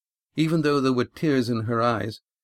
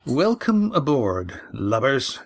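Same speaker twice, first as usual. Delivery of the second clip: sardonic ship's master; 3 semitones higher, slower than usual. Acted. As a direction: sneer the greeting like a pirate captain. Ship's master greets captives.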